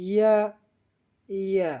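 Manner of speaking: "ইয়া" from the middle of the tongue against the palate; mid-tongue sound. Shown as a demonstration. Teacher showing learners the letter, "ইয়া" from mid tongue. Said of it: "জিহ্বার মাঝখান থেকে".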